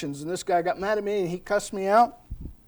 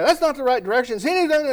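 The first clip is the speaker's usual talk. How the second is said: mock yell; 7 semitones higher, 4 dB louder than usual. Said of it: "starts yelling at this guy".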